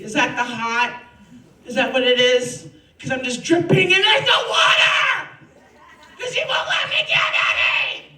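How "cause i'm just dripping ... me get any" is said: higher, louder building to a scream; rising shout. Acted. Comedian raging at the yoga teacher, rising shout. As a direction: build the rant into a yell.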